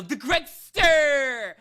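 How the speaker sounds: loud, high, long-drawn nickname reveal; mock-grand announcement. Friend revealing who the culprit is, mock-grand announcement. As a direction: stretch it out like a hammed-up ring announcer.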